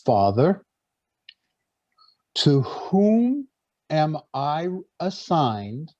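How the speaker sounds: slowly, with long pauses between phrases; slow, deliberate. Shown as a demonstration. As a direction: spell out the question phrase by phrase, unhurried. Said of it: "I'll say it slowly".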